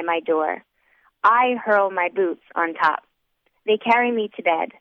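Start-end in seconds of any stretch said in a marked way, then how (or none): none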